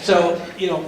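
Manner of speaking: ordinary talk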